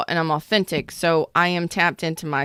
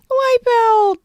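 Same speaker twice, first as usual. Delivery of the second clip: high shout; 6 dB louder than usual. Pretend. Shouting the word again.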